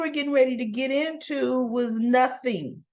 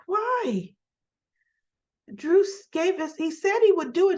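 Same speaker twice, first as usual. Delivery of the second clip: retold startled protest; 9 semitones higher than usual. Retold shocked reaction to news.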